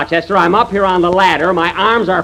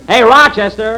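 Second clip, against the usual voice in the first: calling out; 7 semitones higher, 5 dB louder than usual. Acted. Jack calling Rochester from the tree, a loud call. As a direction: call the name out, higher and louder. Calling the butler from another room.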